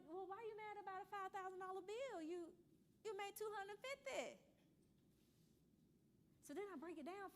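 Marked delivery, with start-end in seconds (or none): 0.1-4.4 higher, mock-incredulous challenge; teasing disbelief at the client; incredulous retort. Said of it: "And I was like".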